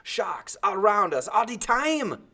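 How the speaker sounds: slow, high, loud roar of rage; angry roar. A narrator's reading. Timothy rages at the boy on the raft, slow roar. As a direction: roar it out, still furious. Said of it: "he roared".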